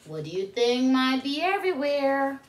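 ordinary delivery

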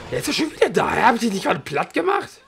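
high, loud outraged disbelief at "wieder"; he's back already?!; indignant gamer yelp; surprised disbelief. Real, not acted. Enemy reappears after defeat.

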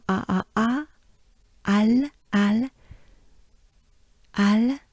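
"A" and "Al" as separate French syllables. Shown as a demonstration. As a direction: open French A, then "Al". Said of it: "French A".

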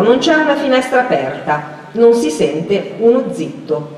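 plain reading voice

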